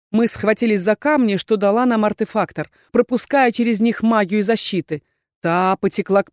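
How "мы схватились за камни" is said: ordinary delivery, nothing marked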